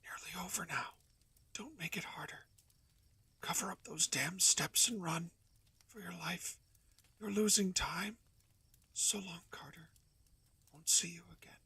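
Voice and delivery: faint dying whisper. Acted. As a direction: whisper farewell with last strength. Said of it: "Here Warren's whispers swelled".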